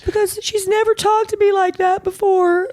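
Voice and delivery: high, whiny imitation of a teary girl; mock sobbing teen voice; imitating crying daughter. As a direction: squeak it out like an upset kid.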